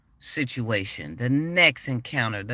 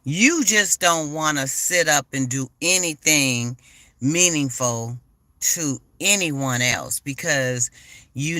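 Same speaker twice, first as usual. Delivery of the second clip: usual speaking voice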